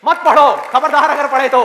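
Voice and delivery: loud, high-pitched order not to study; forbidding warning. Acted. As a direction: shout it as a stern threat. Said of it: "पूरी दुनिया आकर के आपको कहे".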